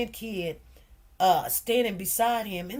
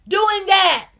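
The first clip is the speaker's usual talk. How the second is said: loud, higher burst of outrage; outraged shout; 8 semitones higher, 10 dB louder than usual. Real, not acted. Mother outraged at the mocking attorney, loud shout. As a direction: throw it out indignant and loud.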